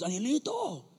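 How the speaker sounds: a lightly voiced call from across the way; calling his name. Teacher calling out to the young boy by name. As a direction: call out his name, a little distant and apart. Youth teacher calls out to him.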